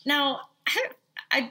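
ordinary delivery

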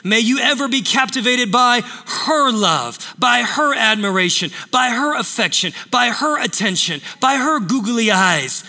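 each her punched in a building list; stress on her. Shown as a demonstration. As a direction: hammer her in every phrase. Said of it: "the emphasis is to be on her".

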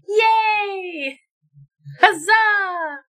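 high, loud cheering; excited cheer. Real, not acted. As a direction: whoop with glee. Cheering for initiative roll.

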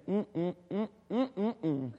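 tut-tut hums with a stifled laugh; disapproving wife. Acted. Preacher mimicking Michal sneering at dancing David, disapproving hums. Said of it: "His wife is sitting ... house saying".

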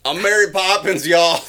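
Mary Poppins as a Southern belle; hammed-up y'all; mock Southern Mary Poppins. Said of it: "Deep South".

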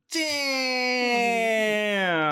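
long, high stretched-out damn; drawn-out damn. Retold or imitated. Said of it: "I was like".

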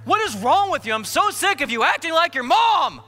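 high, loud heated shout; angry shouting. Retold or imitated. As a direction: shout at the wife mid-fight. Fight with wife.

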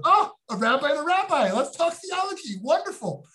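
high-pitched, eager delight; naive enthusiasm. Acted. Naive newcomer rabbi greeting a fellow rabbi as thought partner, eagerly delighted. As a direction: gush like a hopeful newcomer, pitched well up.

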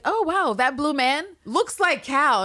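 amazed. Retold or imitated. Kid amazed.

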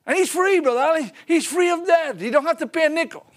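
high, loud, rejoicing proclamation; jubilant preaching. Real, not acted. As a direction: shout the good news of freedom. Preacher exults over the forgiven debt.

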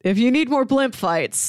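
normal talking voice

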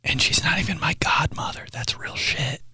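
hushed whisper, conspiratorial; whispered aside. Real, not acted. Whispering to viewers about the aunt. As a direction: whisper it like a secret.

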